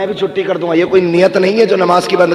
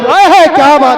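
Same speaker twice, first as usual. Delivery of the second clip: high-pitched burst of approval; exultant aside to the crowd; delighted exclamation; 11 semitones higher, 5 dB louder than usual. Real, not acted. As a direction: cry out in admiration. Exclaims approval amid the chant.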